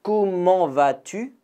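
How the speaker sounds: slow, careful question for learners; slow model. Shown as a demonstration. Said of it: "Donc une nouvelle fois".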